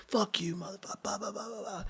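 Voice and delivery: high, whispery imitation of a rant; muted mock cursing. Friend cursing after being kicked, imitated in a hushed rant. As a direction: curse under the breath in mimicry.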